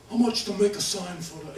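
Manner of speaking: lightly voiced boss asking a price; imitating Restivo. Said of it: "he really did talk like that".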